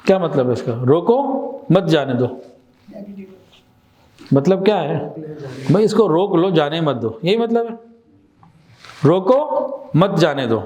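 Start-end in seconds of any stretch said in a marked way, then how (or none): 0.9-2.3 slow, with a clear stop after روکو; pause after روکو. Shown as a demonstration. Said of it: "روکو پہ اوپر". 9.1-10.6 slow demo, long stop after روکو; pause after روکو. Shown as a demonstration. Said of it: "روکو پہ اوپر".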